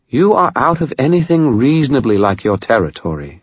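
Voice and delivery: high-pitched, formal British baroness; precise British accent. A narrator's reading. Vampire baroness warns an intruder, precise British accent. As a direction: lay down the law in precise British. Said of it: "in a precise British accent".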